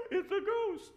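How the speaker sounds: very high shrieking it's a ghost; mock terrified shriek. Disciples squealing at Jesus, mock terrified and very high. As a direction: squeal it like scared kids. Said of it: "they're all like".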